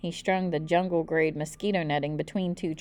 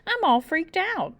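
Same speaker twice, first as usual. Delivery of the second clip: put-on high, slow, panicky child's wail; freaked out cry; 10 semitones higher, 3 dB louder than usual. A narrator's reading. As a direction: wail it like a scared kid. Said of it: "I cried".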